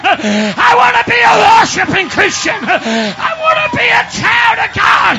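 high, shouted sermon climax; full-force preaching. Real, not acted. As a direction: shout each phrase slowly at full voice, gasping between.

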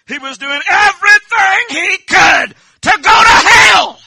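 loud, high preacher's shout; preaching at full force. Real, not acted. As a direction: shout the warning like a hellfire preacher.

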